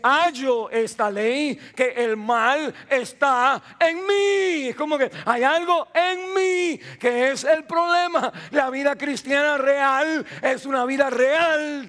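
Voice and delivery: agudo, fuerte y lento; predicación culminante. Real, not acted. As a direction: clamar el clímax del sermón. Clímax de la predicación. (predicación a toda voz)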